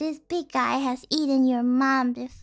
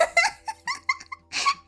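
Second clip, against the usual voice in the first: real, very high squeally laughter; high laughing fit. Real, not acted. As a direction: laugh helplessly at your own joke. Cracks up at own pun.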